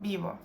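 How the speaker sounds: vivo with the stress on the first syllable "vi-"; penultimate-syllable stress. Shown as a demonstration. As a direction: lean on the opening syllable of "vivo". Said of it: "falls on the penultimate syllable".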